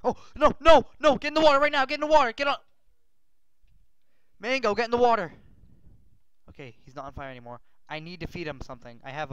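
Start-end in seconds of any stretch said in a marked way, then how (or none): 0.0-5.3 frantic yelling. Real, not acted. Dog on fire, urging him.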